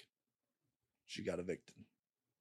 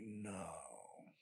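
barely audible, stunned "No"; a hushed, disbelieving no; stunned quiet no; 3 semitones lower, 15 dB quieter than usual. Retold or imitated. Retelling hearing of his brother's death, stunned and hushed.

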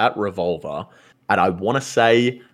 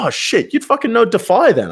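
mock-excited, high and loud; hammed-up amazement at the fan; retold surprise; 13 semitones higher, 4 dB louder than usual. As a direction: play up your own surprise. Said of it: "I was like".

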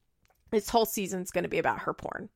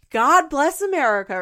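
high, loud mock-patriotic cry; Farrah; 6 semitones higher, 9 dB louder than usual. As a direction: proclaim it with hammed-up fervour. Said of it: "Farrah always talking about America".

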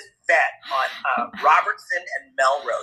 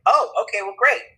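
put-on relief; 5 semitones higher than usual. Acts out his reply to vet.

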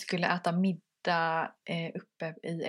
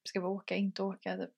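softer, fast anxious questions; hushed worried rush; 6 dB quieter, much faster than usual. Retold or imitated. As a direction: mutter the dilemma quickly and quietly. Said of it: "var ju så här, typ". (tyst och snabbt, oroligt)

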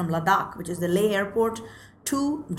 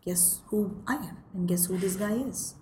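whispered threat; 7 dB quieter, slower than usual. Retold or imitated. Driver turning round to reveal he is a jihadi, slow menacing whisper. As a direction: breathe it out like a threat.

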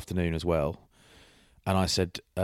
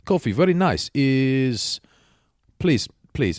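high, loud imitation of a Romanian builder; put-on Romanian accent, pitched up; 6 semitones higher, 6 dB louder, slower than usual. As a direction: imitate a keen Romanian coffee guest. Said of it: "is that a Romanian accent".

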